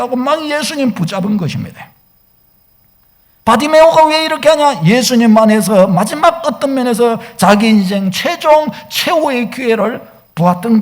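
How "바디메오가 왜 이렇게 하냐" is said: ordinary delivery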